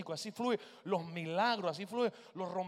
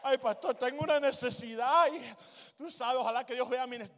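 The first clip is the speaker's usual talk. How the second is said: higher, louder mock plea; tearful pleading; 6 semitones higher, 9 dB louder than usual. Church member begging the pastor about a need, mock tearful pleading. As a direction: play a needy member wailing to the pastor. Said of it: "Dios no responde a lágrimas".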